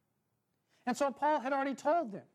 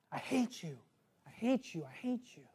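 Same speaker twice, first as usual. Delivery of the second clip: echo; 4 semitones lower, 4 dB quieter than usual. A narrator's reading. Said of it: "words reverberated off the valley walls".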